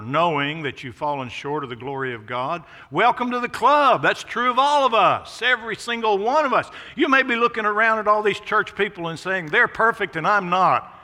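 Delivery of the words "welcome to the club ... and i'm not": much higher and louder, ringing from the pulpit; full-force preaching. Acted. Preacher assuring guilty churchgoers, higher and louder. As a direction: lift the room with a loud call.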